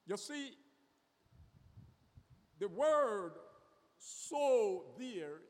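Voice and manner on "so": "so" punched louder and held; stress on SO. Shown as a demonstration. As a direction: lean hard on "so". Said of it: "is placing the emphasis".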